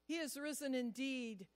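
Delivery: earnest pastoral prayer voice. Acted. Pastor leading the congregation in closing prayer, earnest.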